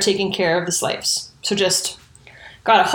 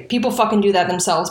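usual speaking voice

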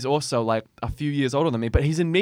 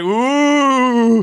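crowd ooh; 9 semitones higher, 6 dB louder than usual. Retold or imitated. Crowd reacting to the taunt.

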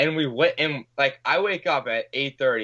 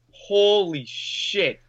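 stunned; 7 semitones higher, much slower than usual. Retold or imitated. Shocked reaction to the burglary charge.

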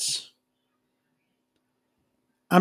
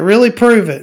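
mock challenge; 6 semitones higher, 7 dB louder than usual. Acted challenge in argument.

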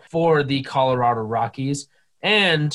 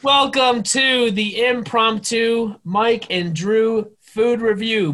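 show host voice; 6 semitones higher, slower than usual. Acted. Mock show opener.

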